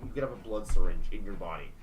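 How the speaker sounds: ordinary talk, nothing marked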